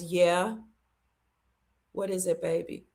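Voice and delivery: soft, low voice of a failing father; weak, quiet reply. Retold or imitated. Dying daddy answering his daughter, hushed and low. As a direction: answer gently with little strength.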